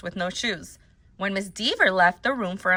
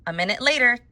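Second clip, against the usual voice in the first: plain reading voice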